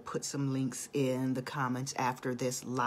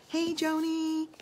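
high, drawn-out delighted hello; excited greeting; 14 semitones higher than usual. Real, not acted. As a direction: sing out a hello to a friend. Greeting a viewer on live.

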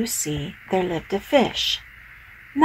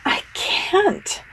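protesting; 11 semitones higher than usual. Acted. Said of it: "started to say".

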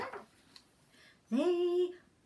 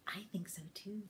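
whisper; 8 semitones lower, 11 dB quieter than usual. Real, not acted. Aside to child.

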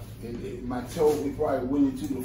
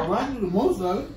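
laughing; 8 semitones higher, 4 dB louder, faster than usual. Real, not acted. Patient answers amid laughter.

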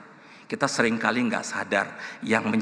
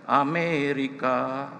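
slow, drawn-out single word; voice from a cassette; 4 semitones higher, much slower than usual. Retold or imitated. Cassette suddenly answering the praying preacher, drawn-out. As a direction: stretch it out like a recorded lyric. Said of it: "langsung keluar dari kasetnya".

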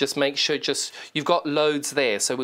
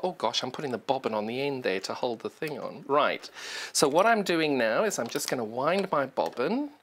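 normal talking voice

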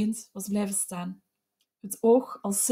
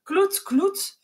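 much higher, raised, wild; frantic call; 10 semitones higher, 3 dB louder than usual. A narrator's reading. Neighbour rushing in, calling out frantically. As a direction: call him off in alarm. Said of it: "riep verwilderd Rossetjev".

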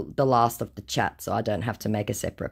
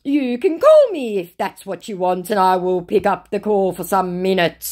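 high, louder mocking scammer read; 7 semitones higher, 5 dB louder than usual. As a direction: parody the scammer's broken English. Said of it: "to which he responds with".

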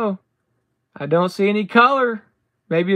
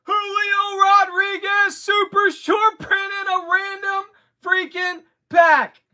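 excited shout; 7 semitones higher than usual. Real, not acted. Showing a rare card to viewers, excited shout. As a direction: announce it like a home run. Pulls a super short print.

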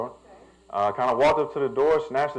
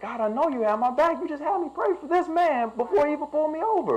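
much higher, fervent thanks to God; grateful to God; 12 semitones higher than usual. Retold or imitated. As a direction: lift it high in relieved praise. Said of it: "I'm thankful, like".

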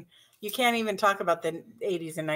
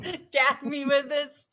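put-on Valley Girl with a laugh; 4 semitones higher than usual. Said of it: "in Valley Girl talk".

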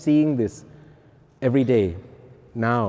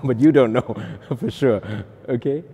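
laughing; 4 dB louder than usual. Real, not acted. Laughing admission he might be lying.

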